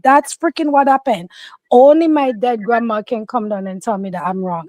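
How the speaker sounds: normal talking voice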